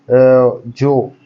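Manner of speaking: जो said loud and held long; drawn-out जो. Shown as a demonstration. Teacher showing students the heavy sound of जो. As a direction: lean hard on जो. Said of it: "जो में pronunciation ज़्यादा आ रहा है".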